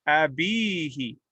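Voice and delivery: abihi with bi held long; long ya vowel. Shown as a demonstration. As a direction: stretch abihi slowly on the first ee. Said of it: "harmonious with the ya before it".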